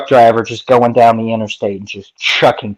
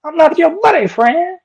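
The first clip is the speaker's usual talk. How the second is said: much higher, squeaky cartoon Canadian accent; South Park Canadian; 13 semitones higher than usual. Quoting South Park to friends, squeaky high Canadian voice. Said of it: "South Park ... make fun of Canadians".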